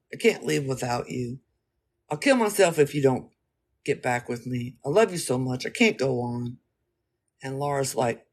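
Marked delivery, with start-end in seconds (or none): none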